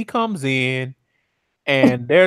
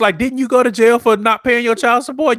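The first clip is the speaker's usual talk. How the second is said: higher and louder, laughing disbelief; excited roast; 11 semitones higher, 6 dB louder than usual. Real, not acted. Roasting Caesar to cohosts, loud and gleeful. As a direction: roast him with gleeful outrage.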